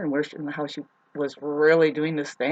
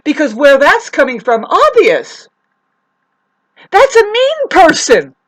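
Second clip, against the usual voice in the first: much higher and louder, indignant; worked-up rant; 10 semitones higher, 13 dB louder than usual. Real, not acted. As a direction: vent it, voice raised. Angry retelling of the fight.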